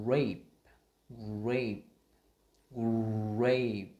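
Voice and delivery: "grape" loud, the gr run slowly into the vowel; R blend demo. Shown as a demonstration. Teacher linking g and r, exaggerated blend. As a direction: round into the r and burst out "grape". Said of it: "mình sẽ nối sang cái từ là".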